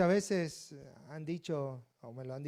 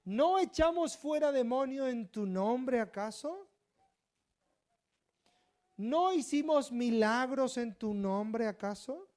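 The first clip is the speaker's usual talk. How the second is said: high-pitched, slow incredulous question; great surprise; 9 semitones higher, 6 dB louder, slower than usual. Acted. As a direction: protest in astonishment, rising at the end. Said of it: "con gran sorpresa en aquel día".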